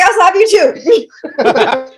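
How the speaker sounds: laughing threat. Retold or imitated. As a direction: drawl the threat while cracking up.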